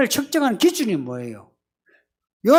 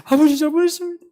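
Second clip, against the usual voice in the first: fake crying apology; 7 semitones higher, faster than usual. Son pretending to cry so his father forgives him. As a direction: ham up a tearful sorry. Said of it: "우는 척이라도".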